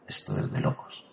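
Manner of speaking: breathless, disbelieving whisper; stunned whisper. Real, not acted. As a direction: mutter it under your breath in shock. Overwhelmed by the revelation. (susurro incrédulo)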